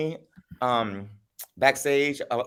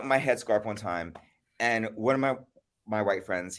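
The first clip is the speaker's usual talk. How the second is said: ordinary delivery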